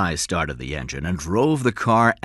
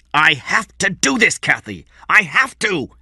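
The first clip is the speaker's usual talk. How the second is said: higher, forceful insistence; angrily; 8 semitones higher, 4 dB louder than usual. A narrator's reading. Eddie angrily refusing Kathy, raised voice. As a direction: snap back at her, raised. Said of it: "Eddie said angrily".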